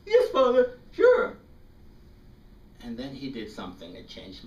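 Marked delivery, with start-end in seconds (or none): 0.1-1.4 much higher, louder nervous reply; timid boy. Retold or imitated. Boy answering the priest, high nervous yes. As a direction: squeak out an eager yes.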